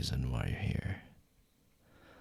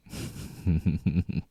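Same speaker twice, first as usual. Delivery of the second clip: teasing laugh; 4 semitones higher, 4 dB louder than usual. Acted. Laughs after "you really want me.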